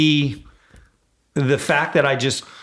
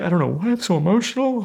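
higher, lightly voiced tearful wife; hammed-up emotional wife, not real crying; mock weepy wife; 8 semitones higher than usual. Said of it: "my wife went into absolute hysterics".

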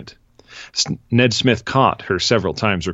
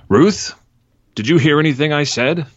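complaining; 8 semitones higher than usual. Acted.

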